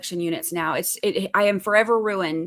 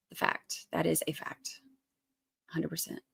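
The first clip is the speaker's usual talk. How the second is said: breathy whispered aside; 4 semitones lower, 13 dB quieter than usual. Real, not acted. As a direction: lean in and whisper it.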